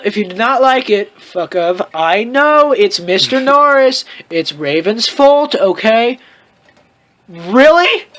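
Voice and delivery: ordinary talk, nothing marked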